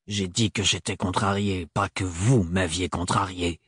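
homme froid, voix plus grave; voix de Thorne. A narrator's reading. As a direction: Thorne sec et distant, plus bas. Thorne voiced by narrator. (Thorne répond à Ophélie, voix grave et posée)

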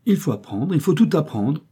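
put-on a boy's self-important pronouncement, pitched high; knowing air. A narrator's reading. Little boy lecturing his mother, self-important child voice. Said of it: "dit maître Jean d'un air capable".

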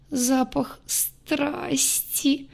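very slow, soft moan. Acted. Aya moans the perfume's name, very slow. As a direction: moan it out tearfully. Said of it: "простонала девушка".

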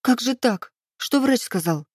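high, distressed questioning; upset, lifted voice; tearful. A narrator's reading. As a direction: ask anxiously, close to sobbing. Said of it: "всхлипнула".

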